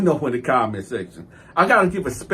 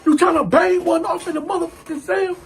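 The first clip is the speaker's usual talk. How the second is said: high-pitched, outraged retelling of a challenge; indignant, high-pitched accusation; angry confrontation. Retold or imitated. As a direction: confront a cellmate in disbelief. Retold confrontation with cellmate.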